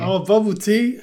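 loud, high surprise; surprised. Real, not acted. Surprised mishearing realization.

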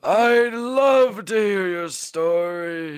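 slow hammed-up guest voice; Chris Ventura voice. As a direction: draw it out sweetly as the pretend Chris. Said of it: "how are you, Chris?".

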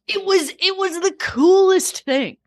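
high, drawn-out wonder; slow down and marvel, pitched right up; awestruck excitement. Real, not acted. Remembering the balloon for the co-host, awestruck. Awed reaction to vanished balloon.

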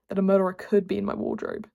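ordinary delivery

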